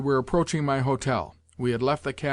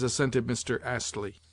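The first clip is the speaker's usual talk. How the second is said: ordinary delivery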